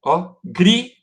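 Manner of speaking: "Se você, ó, grita" slowly, split syllable by syllable; syllable separation. Shown as a demonstration. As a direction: break "grita" into GRI and TA with pauses. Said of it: "Olha a separação aqui".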